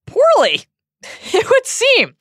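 high amused laugh. Real, not acted. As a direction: laugh the words out, drawn out. Amused aside on Hagrid.

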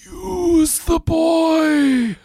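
high, slow shouted villain line; Voldemort voice. Pretend. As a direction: screech it like a dark lord. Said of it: "Voldemort two".

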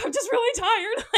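high little whimper through laughter; mock crying. Imitating a tired eight-year-old at curtain call, mock crying. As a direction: imitate a tearful, worn-out small child, half laughing. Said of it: "starting to cry a little bit".

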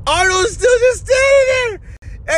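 very high-pitched shout of disbelief; incredulous yell. Retold or imitated. Podcaster retelling his reaction to the video, incredulous yell. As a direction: yell it high, amazed at what you saw.

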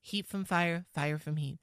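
light voice with little chest resonance; feminized key phrase. Shown as a demonstration. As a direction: constrict and lift into feminine voice. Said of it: "key phrase that you know how it sounds".

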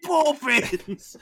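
high, drawn-out hammed-up cry; exaggerated put-on party-trick voice; Mary Poppins bit. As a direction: stretch the name out in a silly high voice. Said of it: "do your Mary Poppins".

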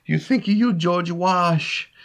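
high, hammed-up Italian accent. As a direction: play the excited Italian groundskeeper in a thick Italian accent. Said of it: "with his very Italian accent".

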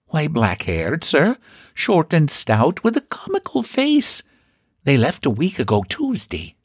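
high, prim landlady voice; a proud, chatty housekeeper; old landlady voice. Acted. Housekeeper answers.

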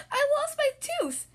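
distressed wail. Acted. Amelia tells Skip, distressed.

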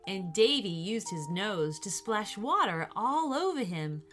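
ordinary delivery

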